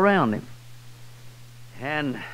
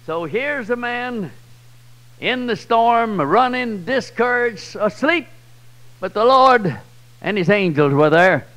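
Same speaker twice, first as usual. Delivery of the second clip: rousing preaching; 4 semitones higher, 5 dB louder than usual. Real, not acted. Preacher urging his congregation, high and loud. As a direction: lift them up with it.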